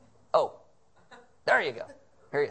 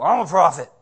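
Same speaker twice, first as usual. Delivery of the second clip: loud, slurred mock drifter voice; 10 dB louder than usual. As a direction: play a scruffy guy under an overpass announcing himself. Mocking highway drifters.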